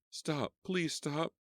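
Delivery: high pleading shout to passing car; frantic calling out. Acted. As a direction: flag down the driver in panic.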